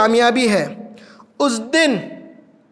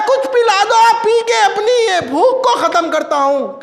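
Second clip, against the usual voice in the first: high-pitched, raised-voice plea; acted begging; 8 semitones higher than usual. Punished man begging for a drink in hell, acted plea. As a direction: cry out for relief in a lifted pitch.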